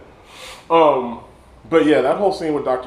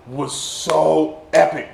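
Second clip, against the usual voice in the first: normal talking voice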